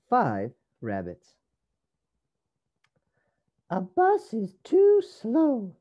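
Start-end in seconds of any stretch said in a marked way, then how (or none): 3.7-5.7 high, drawn-out character voice; loud, slow, high complaint; little rabbit Rosie. A narrator's reading. Rosie grumbles about the bus to her sisters, high character voice. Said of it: "said Rosie".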